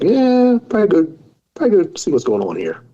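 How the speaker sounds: yelped high yeah, then a fast grudging mumble; mock reluctant self. Brushing off the ultrasound appointment, mock reluctant. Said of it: "Then I was like".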